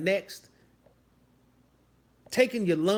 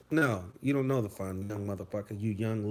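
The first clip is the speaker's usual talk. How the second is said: usual speaking voice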